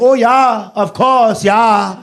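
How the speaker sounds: high, mock Scandinavian-turned-German accent; camp German accent. American botches Swedish accents, camp German accent.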